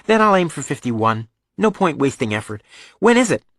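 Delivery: put-on high-pitched, brisk-minded young son; offhand boy. Son planning minimum effort for the test, offhand.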